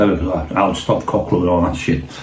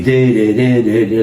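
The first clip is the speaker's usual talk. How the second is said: a mouth siren, chanted up and down; approaching cop car siren, mimicked; imitated police siren; 2 semitones higher, slower than usual.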